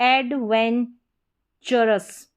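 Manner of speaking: "एडवेंचरस" spelled out slowly, ending in -चरस; slow model word. Shown as a demonstration. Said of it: "T U R O U S को साथ में पढ़ते हैं".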